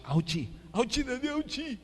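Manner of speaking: put-on "Ouchie, Daddy" rising into a high, babyish whine; imitating a child. Pastor imitating his hurt child whining "ouchie" to daddy. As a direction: copy a small hurt kid whining to dad. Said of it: "Instead na ouch".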